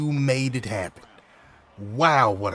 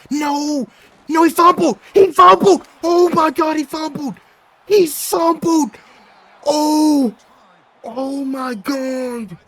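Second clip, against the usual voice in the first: much higher, much louder screaming joy; ecstatic shouting; 13 semitones higher, 10 dB louder than usual. Real, not acted. Gamer screaming as the opponent fumbles, ecstatic. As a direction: scream it like the game just turned.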